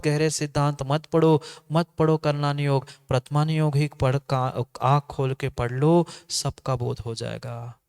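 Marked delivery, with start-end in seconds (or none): none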